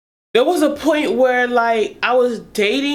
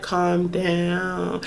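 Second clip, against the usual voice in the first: calming quiet; 5 semitones lower, 5 dB quieter than usual. Retold or imitated. Friend calming her.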